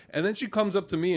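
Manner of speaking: normal talking voice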